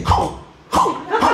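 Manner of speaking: hooting mock snore, hau hau; comic hooting snore; another snore. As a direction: imitate a snorer who hoots. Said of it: "ada lagi yang gini".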